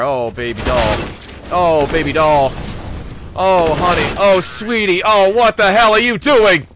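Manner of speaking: loud, put-on sugary voice scolding the player; mock doting scold. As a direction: coo then snap like an exasperated mom. Mock pet names at bad play.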